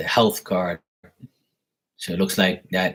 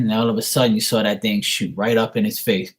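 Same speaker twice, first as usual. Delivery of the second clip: normal talking voice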